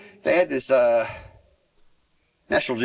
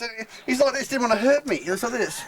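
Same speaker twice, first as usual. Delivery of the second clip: ordinary talk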